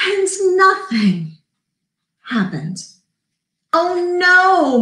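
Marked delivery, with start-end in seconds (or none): 3.7-4.8 high, drawn-out groan of dismay; moaning. A narrator's reading. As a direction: hold the NO in a long moan. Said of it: "moaned the crowd again".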